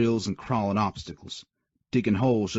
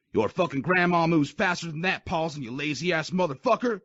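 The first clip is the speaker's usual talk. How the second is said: spewing insults; 9 semitones higher than usual. Acted. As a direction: spit insults in his face. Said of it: "he spewed in the private's face".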